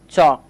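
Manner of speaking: চক as "chawk", open rounded vowel. Shown as a demonstration. Teacher models another example word, AW vowel. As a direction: say চক loudly on its own with AW. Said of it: "অ এর মতো উচ্চারণ করছি".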